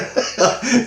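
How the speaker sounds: high giggle; high laugh. Real, not acted. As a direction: keep laughing. Laughing at alien's name.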